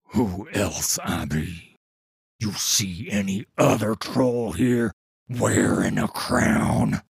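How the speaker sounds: low, slow, loud troll growl; rumbling gravelly voice. A narrator's reading. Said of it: "Yedu answers".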